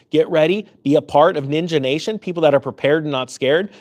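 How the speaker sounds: normal talking voice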